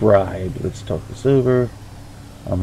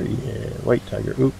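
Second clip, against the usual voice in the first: usual speaking voice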